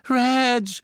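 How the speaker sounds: high, loud cry of a name; scream. A narrator's reading. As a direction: scream for your husband. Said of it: "schrie Misses Cattermole".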